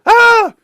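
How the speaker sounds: high shrieked Ah; a hammed-up panicked shriek; loud high mock yelp of panic; mock scream. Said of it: "They run back outside".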